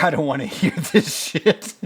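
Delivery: high, loud put-on voice brushing him off; mock cold dismissal. Rust waving off Marty's family talk, mocking high voice. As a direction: sneer it away mid-laugh. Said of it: "he's like".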